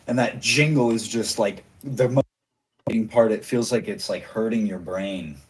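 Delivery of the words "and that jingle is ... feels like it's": normal talking voice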